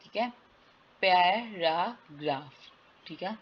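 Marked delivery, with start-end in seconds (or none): 1.0-2.5 "पैराग्राफ" slowly split as पै, रा, ग्राफ; syllable by syllable. Shown as a demonstration. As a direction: sound out "पैराग्राफ" in three drawn-out beats. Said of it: "वीक vowel sound क्या है". ("पैराग्राफ" को तोड़कर धीरे बोलें)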